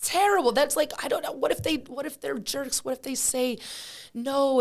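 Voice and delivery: high, fretful protest, pitch climbing; mock panic. To her little brother at the bus, mock panicked. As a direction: squeak out the nervous objections.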